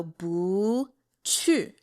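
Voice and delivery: Bu rising and stretched, then Chu falling; second tone on Bu. Shown as a demonstration. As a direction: lift Bu, drop Chu. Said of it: "it turns to the second tone".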